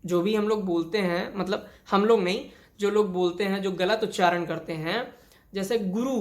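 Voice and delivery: usual speaking voice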